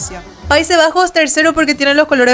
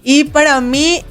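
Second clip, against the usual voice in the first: normal talking voice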